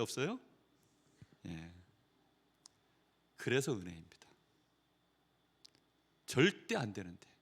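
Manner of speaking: ordinary talk, nothing marked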